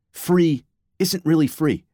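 free isn't really free, each word slowed and leaned on; slow emphatic stress. Real, not acted. Narrator hammering home a point to listeners, slow and emphatic. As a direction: drag it out to land the point.

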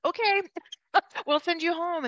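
sarcastic chirpy hospital voice; mimicking the hospital's careless okay; mocking. Mocking hospital's easy agreement.

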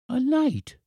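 put-on very high child's exclamation; astonished boy. A narrator's reading. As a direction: echo it as an amazed little boy.

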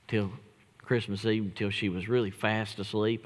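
usual speaking voice

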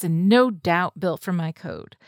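usual speaking voice

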